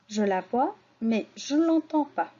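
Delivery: ordinary talk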